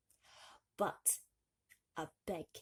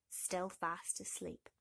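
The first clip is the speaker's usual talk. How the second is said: plain reading voice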